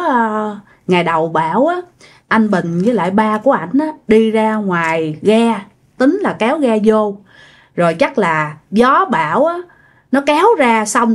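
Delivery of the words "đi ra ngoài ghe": ordinary delivery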